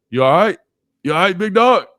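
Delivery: loud, high Southern "big dog" call; hammed-up Southern accent; Southern drawl imitation. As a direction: mimic a Florida teammate checking on you. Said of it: "Zane talk like this 'cause he's, like, from Florida".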